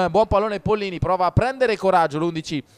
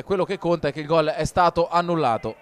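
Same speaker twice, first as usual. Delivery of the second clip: ordinary talk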